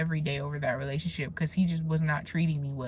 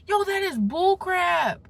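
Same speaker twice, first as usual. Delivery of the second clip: scoffing; 11 semitones higher than usual. Real, not acted. Rejecting bad advice.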